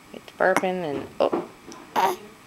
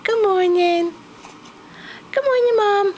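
put-on very high, louder squeaky baby voice; voicing the baby; 6 dB louder than usual. Mother voicing her baby's good morning, squeaky and high. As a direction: speak as the baby greeting Mom.

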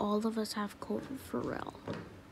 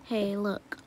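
ordinary delivery

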